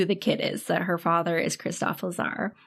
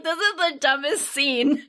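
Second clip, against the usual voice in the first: higher, slow laugh-cry at a dumb scene; laughing disbelief; 8 semitones higher, slower than usual. Retold or imitated. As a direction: crack up at the film. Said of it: "I was like".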